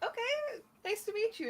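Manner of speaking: put-on soft high young voice; high girlish voice. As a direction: greet softly in a high voice. Elda greets Sebastian.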